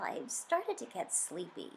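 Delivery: ordinary delivery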